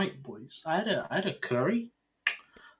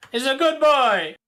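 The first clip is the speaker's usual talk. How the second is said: higher and louder, insisting; loud insistence; 11 semitones higher, 7 dB louder than usual. Real, not acted.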